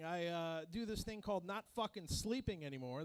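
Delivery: usual speaking voice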